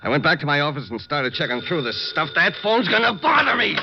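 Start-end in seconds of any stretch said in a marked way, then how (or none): 2.3-3.8 sudden angry shout, much higher and louder; loud irritated yell; angry outburst. Acted. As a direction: lose patience with the ringing phone. Gunman snaps at ringing phone.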